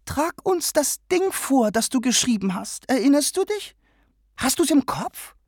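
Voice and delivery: hohe, eifrige Bitte; plötzlich, drängend. A narrator's reading. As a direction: fordert Joe plötzlich auf. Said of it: "sagte Mister O'Connor plötzlich". (O'Connor bittet Joe plötzlich, sein Gedicht vorzutragen)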